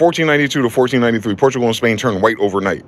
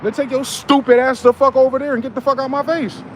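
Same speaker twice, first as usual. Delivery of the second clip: mock angry shout; 11 semitones higher than usual. Retold angry dismissal of him.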